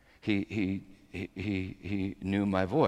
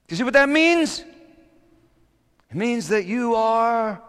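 high, emphatic pulpit delivery; raised, fervent sermon voice; impassioned preaching; 10 semitones higher, 9 dB louder than usual. Real, not acted. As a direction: preach it out, stretching the big phrases. Preaches on the psalm.

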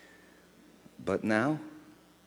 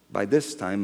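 normal talking voice

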